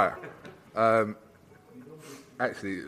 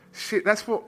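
surprised inner thought; 13 semitones higher, 6 dB louder than usual. Retold or imitated. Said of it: "in my head, I was thinking".

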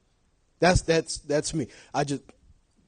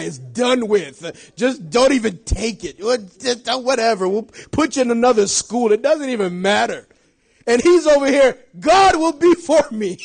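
loud, high preacher's delivery; full-force preaching; 7 semitones higher, 11 dB louder, slower than usual. Acted. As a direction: preach it out to the whole church.

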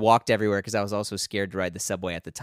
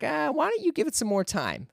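gentle dad voice. Retold or imitated. Dad gently advising patience.

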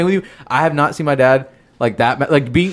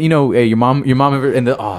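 ordinary talk

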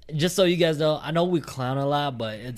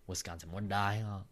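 ordinary talk, nothing marked

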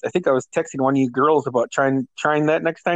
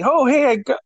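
much higher, light mom voice; a cheerful mother; mom's voice; 10 semitones higher than usual. Retold or imitated.

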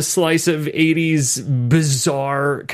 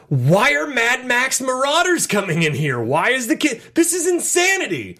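rising disbelief, higher at the end; baffled outburst; 7 semitones higher than usual. Retold or imitated. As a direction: exclaim it in mounting disbelief. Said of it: "where I'm like".

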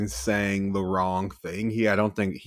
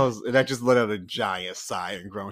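ordinary talk, nothing marked

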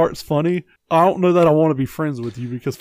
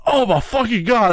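mock disgust outcry; 7 semitones higher than usual. Imitates Doug smelling the fart.

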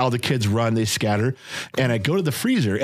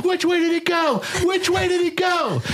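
put-on high, urgent little-girl voice, much higher; excited little girl. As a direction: squeal it like a seven-year-old chasing a limo. Seven-year-old daughter excited.

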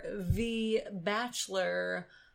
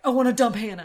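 imitating Colton; 2 semitones higher, 10 dB louder, much faster than usual. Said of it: "Colton was probably like".

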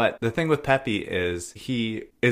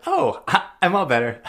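high, louder mock-perky turnaround; sudden recovery; 10 semitones higher, 4 dB louder than usual. Heroine reading the letter, suddenly high and perky. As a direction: leap up in pitch as if instantly cured. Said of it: "she was like".

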